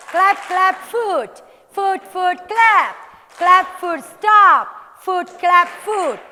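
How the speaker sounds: loud, high, punchy rhythm calls; drill-leader shouting; energetic shouted calls. Shown as a demonstration. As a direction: shout the beats to the class with energy. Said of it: "Put energy in your voice".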